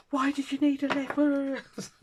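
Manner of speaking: high, slow, alarmed daughter voice; a worried daughter squeaking the question; mimicking daughter. Mother mimicking her daughter on the phone, high and slow.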